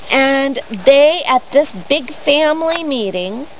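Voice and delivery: ordinary delivery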